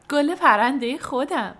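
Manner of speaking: little laugh, then high, loud delight; smiling, delighted. A narrator's reading. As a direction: say it through a smile, voice lifted high. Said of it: "با لبخند گفت".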